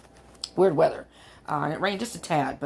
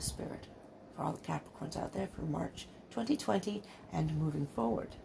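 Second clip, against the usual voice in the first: soft, reverent invocation to Spirit; hushed prayer to Spirit; 8 dB quieter than usual. Real, not acted. Tarot reader softly asks Spirit for Capricorn messages, hushed and reverent. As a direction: quietly call on Spirit, eyes closed.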